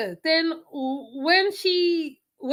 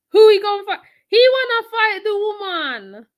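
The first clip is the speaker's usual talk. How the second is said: high, loud, crying-out shout at the idea; outraged shout; 7 semitones higher, 8 dB louder than usual. Real, not acted. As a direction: yell it in disbelief, voice cracking. Outraged rant about fighting woman.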